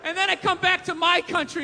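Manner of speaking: normal talking voice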